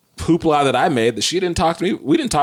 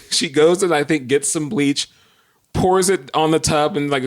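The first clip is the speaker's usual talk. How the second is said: ordinary talk, nothing marked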